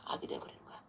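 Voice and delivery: whispered repeat, "악이" barely breathed. Shown as a demonstration. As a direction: whisper "악이 돼버리는 거야" with no voice.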